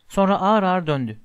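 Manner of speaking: usual speaking voice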